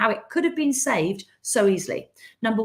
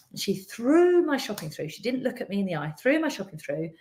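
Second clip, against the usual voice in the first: ordinary delivery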